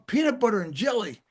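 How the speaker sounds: disbelieving repeat of "Peanut butter and jelly?"; retelling his shock at the staff kid; incredulous. Retold or imitated. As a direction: say "Peanut butter and jelly?" as if you can't believe it. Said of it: "I'm going".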